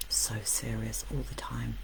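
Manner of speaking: very soft, low whisper; hushed repetition. Real, not acted. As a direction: drop into a whisper for emphasis. Repeats point in a hush.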